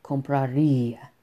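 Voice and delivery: compraría with the í held and falling; stress on the í. Shown as a demonstration. Teacher showing students the accented í, compraría with the í held. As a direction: lean on the í in compraría. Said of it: "stress en la i".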